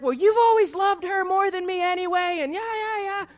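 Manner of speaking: high, loud accusing wail; out-of-control outburst. Acted. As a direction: act out a family fight boiling over. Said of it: "our emotions really get out of control".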